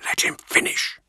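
sharp hissed whisper; urgent resistance whisper. Acted. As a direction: hiss it under your breath. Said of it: "Nemiel hissed".

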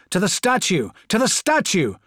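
high, loud battlefield roar; roared order. A narrator's reading. Corvo to his Space Marines under fire, roared order. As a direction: roar the order across the square. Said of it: "Corvo roared".

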